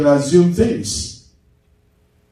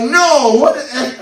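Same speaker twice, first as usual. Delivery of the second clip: mock angry yell; 9 semitones higher than usual. Imitating the man's outburst.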